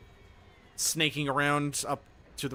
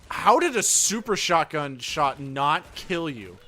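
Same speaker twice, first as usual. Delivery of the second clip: exasperated; 2 semitones higher, 8 dB louder, slower than usual. Real, not acted. Enemy survives a shotgun shot.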